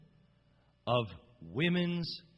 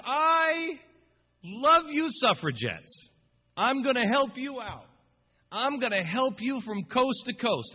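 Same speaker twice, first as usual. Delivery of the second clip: hammed-up pitchman; 8 semitones higher, 5 dB louder, slower than usual. Bernays voiced as a salesman.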